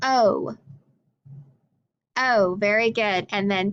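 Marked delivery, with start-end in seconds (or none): 0.0-0.6 oh, long o of overalls, held; long o sound. Shown as a demonstration. Teacher modelling the long vowel oh for overalls. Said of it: "I'd say".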